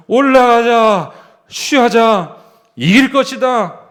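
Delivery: bold battle cry. Retold or imitated.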